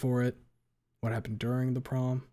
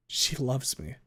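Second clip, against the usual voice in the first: plain reading voice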